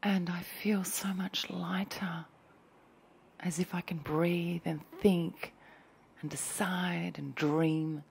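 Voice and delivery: slow dreamy whisper; hushed ASMR musing. Acted.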